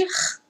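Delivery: "R" as a pure puff of breath, no vowel; English H sound. Shown as a demonstration. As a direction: exhale the "R" like an English H. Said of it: "H em inglês tem o som de". ("R" aspirado, só o sopro)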